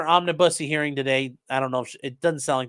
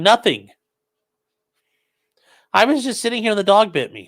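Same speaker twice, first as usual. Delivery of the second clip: put-on little kid; 7 semitones higher, 4 dB louder than usual. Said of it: "sounds like a little kid when a dog bites him".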